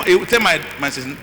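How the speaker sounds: ordinary delivery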